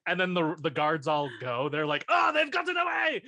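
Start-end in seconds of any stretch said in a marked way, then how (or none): 2.1-3.2 guards' shout. Pretend. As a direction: cry out that they escaped.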